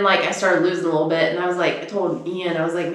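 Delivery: usual speaking voice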